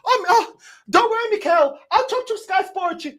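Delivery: mocking the director at Sky Sports, Brazilian-accented; imitating Edu. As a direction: ham up Edu's Brazilian accent to the press. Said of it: "Edu comes in front of Sky Sports".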